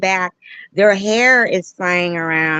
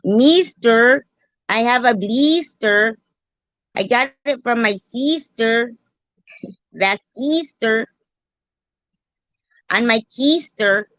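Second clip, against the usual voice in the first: high, rhyming mock-Mexican accent; singsong put-on Mexican accent; 6 semitones higher than usual. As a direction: play up the "ee-ster" rhymes, fake Mexican. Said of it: "Or Mexican".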